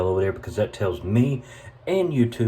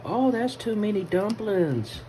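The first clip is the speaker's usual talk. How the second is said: high, drawn-out mock objection; mock complainer; 12 semitones higher, slower than usual. Cook mimicking people objecting to his dumplings, high mock voice. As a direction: imitate fussy critics, slow and way up high. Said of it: "a lot of people be like".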